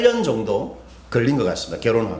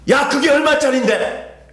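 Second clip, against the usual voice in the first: sudden high angry shout, sharply raised pitch; retold angry yell; 8 semitones higher than usual. Pastor yelling at a youth leader who lost something costly, sudden angry shout. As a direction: snap at her in a burst of anger. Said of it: "이렇게 소리를 질렀습니다".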